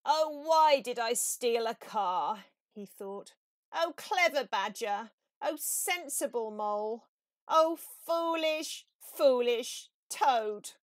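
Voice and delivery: loud, slowing melodramatic wail; lamenting Toad. A narrator's reading. Toad in prison bewails his foolishness, loud and drawn out slowly. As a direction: wail over your own folly.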